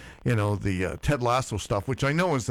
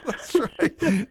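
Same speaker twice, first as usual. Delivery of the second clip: laughing, higher "That's right"; 12 semitones higher than usual. Real, not acted. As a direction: laugh through the words. Laughing at the joke.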